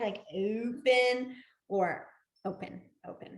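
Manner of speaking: slowed, grand open; grand dramatic. Shown as a demonstration. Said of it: "like the grand dramatic".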